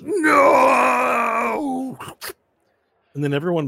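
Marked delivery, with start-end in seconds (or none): 0.0-2.0 high, loud final wail; desperate cry. Acted. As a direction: cry out as he's sucked away. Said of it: "he goes".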